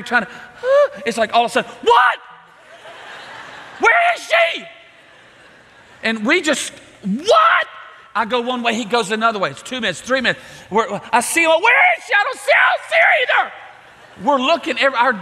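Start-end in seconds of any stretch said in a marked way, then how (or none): 1.8-4.7 high frantic yell; panicked shout. Retold or imitated. Father realizing the daughter is lost, frantic high shout. Child missing, instant panic. 7.0-7.7 high frantic yell; panicked shout. Retold or imitated. Father realizing the daughter is lost, frantic high shout. 11.5-13.5 high, fast panicked yelling; frantic shout. Retold or imitated. Searching for the missing girl, frantic high shout.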